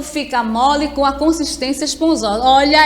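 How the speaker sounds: ordinary talk, nothing marked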